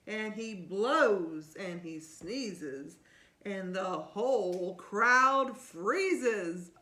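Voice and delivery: put-on lively read-aloud to a child; storybook reading. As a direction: read it like bedtime story time. Reading picture book aloud.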